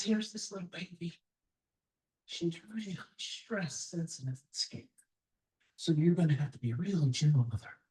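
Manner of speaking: low and hushed, tender with the little one. Pretend. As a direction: speak low as if not to wake the baby. Voicing gentle advice about baby.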